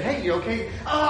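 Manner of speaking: normal talking voice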